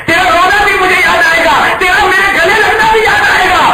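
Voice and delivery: high, loud wailing cry of a grieving father; a father weeping over his son; weeping lament. Acted. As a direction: wail the farewell as if sobbing. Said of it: "ऐसे फूट फूट कर रोए".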